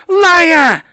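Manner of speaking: high, very loud shriek; screamed accusation. Acted.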